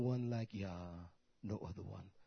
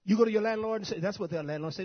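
ordinary delivery

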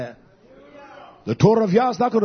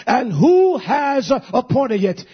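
scripture declaimed; 4 semitones higher, slower than usual. Acted.

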